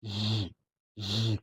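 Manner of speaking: Z as a buzzing zh, as in "vision"; soft, isolated voiced zh for Z; thicker sh. Shown as a demonstration. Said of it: "Dia lebih tebal dari nomor empat belas".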